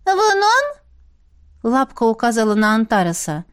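high, cheeky creature voice; defiant otter voice. Acted. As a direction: brush it off like a sassy pet. Otter argues back.

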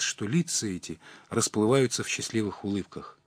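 plain reading voice

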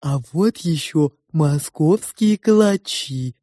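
slow, cooing sow's voice; cooing pig. A narrator's reading. The pig offers food to the guests, cooing. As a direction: coo it slowly, drawing out each vowel.

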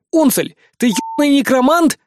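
shouted high and loud at the elf; yelling. Acted. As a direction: yell it in shock. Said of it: "заорал я".

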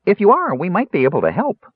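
high, helpful Kender voice; eager. A narrator's reading. Kender offering help to his captors, eager. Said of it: "Tass asked eagerly".

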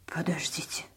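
soft, hesitant interjection; bewildered. A narrator's reading. Woman confused by the news, soft and bewildered. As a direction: break in quietly, at a loss. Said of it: "растерялась она".

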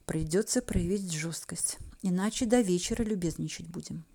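hushed muttered aside; whispering. Acted. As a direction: mutter it under your breath. Muttering to herself.

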